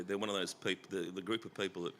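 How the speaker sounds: normal talking voice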